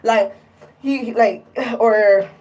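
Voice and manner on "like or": ordinary talk, nothing marked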